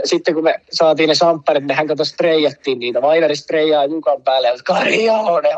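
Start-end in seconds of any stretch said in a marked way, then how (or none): 4.6-5.6 mock shout.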